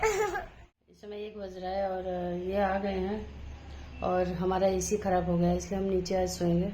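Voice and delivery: sleepy household at one a.m., softly murmured update; late night hush. Real, not acted.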